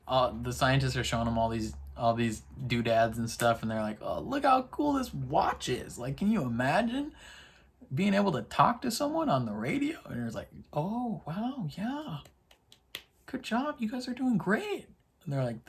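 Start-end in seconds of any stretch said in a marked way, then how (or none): 4.0-10.0 high-pitched, gushing show-and-tell; mock gadget excitement. Scientists showing Gemma their gadgets, mock excitement. 10.7-14.9 high, soft, humoring praise; mock polite admiration. Gemma to the scientists showing doodads, mock admiration.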